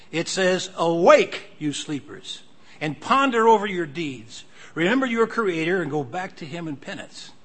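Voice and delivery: preacher's emphatic reading; emphatic quotation reading. Acted. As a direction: read it out forcefully, punching key words. Reads a quotation aloud.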